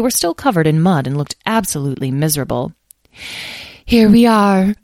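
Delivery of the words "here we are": high, loud lament of a little fairy; put-on sadness, pitched high; sorrowful fairy, raised voice; sad. A narrator's reading. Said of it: "said one sadly".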